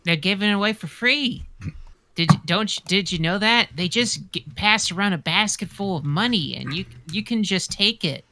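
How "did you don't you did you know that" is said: ordinary delivery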